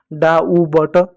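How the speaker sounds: डा उ ब ट in separate pieces, the b still voiced; wrong reading, sounded out. Shown as a demonstration. Teacher testing a half b, डा उ ब ट still wrongly sounded. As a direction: break up डा उ ब ट, sounding the b. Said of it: "फिर भी गलत हो जा रहा है".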